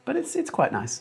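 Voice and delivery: "quite nice" said in a high, squeaky mock voice. Stream chat about the weather.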